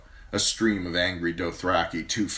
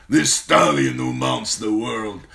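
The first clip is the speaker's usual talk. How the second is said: khal's voice; 3 semitones higher, 8 dB louder than usual. Acted. Khal proclaims his son's title, slow loud warlord voice.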